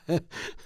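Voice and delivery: soft, voiceless, tentative ha-ha; slow-starting chuckle. Shown as a demonstration. Guest shows how he does not begin, slow soft chuckle. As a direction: demonstrate a half-hearted laugh that barely gets going. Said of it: "Ich fange nicht langsam an zu lachen, so".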